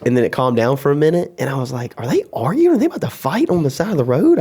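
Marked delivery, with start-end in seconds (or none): 2.0-4.4 high, alarmed retold thought; mock alarmed. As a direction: ask it way up high, as if worried they'll fight. Retelling his own worried thoughts.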